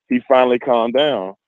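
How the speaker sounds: ordinary talk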